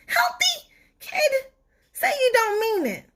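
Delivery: high, crying wail of a talking tooth; horrified tooth wail. Acted. Tooth wails at Stuart over his new diet, crying high. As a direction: wail in disbelief, near tears.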